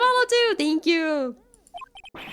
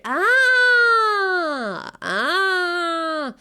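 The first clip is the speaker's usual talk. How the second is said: excited cry; 5 semitones higher, 4 dB louder than usual. Real, not acted. Realizing the name pun.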